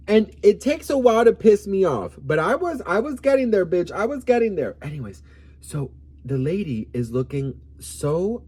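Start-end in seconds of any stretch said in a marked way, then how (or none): 0.1-4.8 higher and louder, heating up; rising heat, loud and high; worked up. Real, not acted. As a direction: let the temper build as you tell it. Telling of nearly losing her temper.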